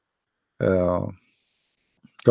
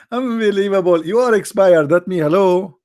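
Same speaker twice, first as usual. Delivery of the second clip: much higher and louder "unbelievable"; gleeful disbelief; 7 semitones higher, 4 dB louder than usual. Real, not acted. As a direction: marvel at it, still laughing. Laughing disbelief after the joke.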